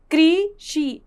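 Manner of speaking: "कृषि" split into drawn-out "क्री", "षि"; sounding out. Shown as a demonstration. Teacher reading out loud with students, sounding out. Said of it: "आइए बोल बोल कर पढ़ते हैं".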